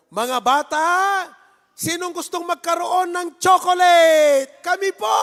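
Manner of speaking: loud, high, eager call about chocolate; rally the kids like you hold out a treat; excited shout to kids. Acted. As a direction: kids cheering for candy. Pastor acts kids offered chocolate.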